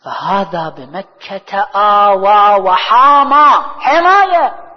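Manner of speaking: very slow, loud declamation; drawn-out proclamation, ringing out loud; emphatic verse recitation. Acted. Recites verse, hammers the key word.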